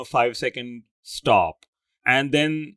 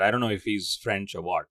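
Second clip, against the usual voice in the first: usual speaking voice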